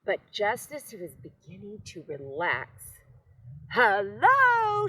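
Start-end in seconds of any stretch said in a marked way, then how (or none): 3.7-4.9 high, loud shouted hello. A narrator's reading. Old tortoise greeting Hank, shouted high. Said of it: "shouted Tortoise".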